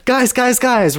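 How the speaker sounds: guys called out high and excited; excited kid calling his family over; joyous screech. Acted. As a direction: squeal for the family's attention. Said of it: "Ronald screeched joyously".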